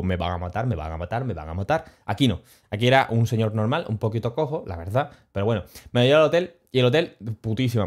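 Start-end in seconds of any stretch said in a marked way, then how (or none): 0.0-1.8 mock scared mumble. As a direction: lower and softer, repeated fretting. Scared in mafia Uber in Rome.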